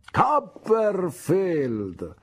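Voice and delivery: high, loud, long-drawn call; Micawber calling out. Acted. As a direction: call across to the boy by the coach. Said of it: "gridò mister Micawber".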